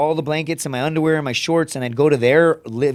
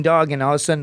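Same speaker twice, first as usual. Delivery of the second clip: ordinary talk, nothing marked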